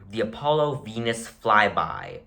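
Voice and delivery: normal talking voice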